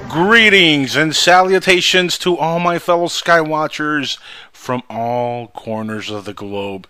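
slow announcer greeting; radio host intro. Acted. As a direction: open the show like a radio host. Radio show opening.

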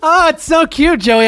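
usual speaking voice

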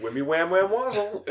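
high, chirpy nonsense talker; imitated upbeat gibberish. Host imitating the alien answering interrogators, upbeat gibberish. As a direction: babble it cheerfully, as if answering sensibly. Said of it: "he has a very upbeat to-, a tone of voice".

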